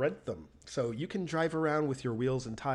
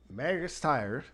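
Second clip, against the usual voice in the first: imitated thick Southern Georgia accent; Georgian accent; 3 semitones higher, 4 dB louder, slower than usual. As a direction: say "Megas Tire" in a Georgia drawl. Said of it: "in, like, his deepest Georgian accent".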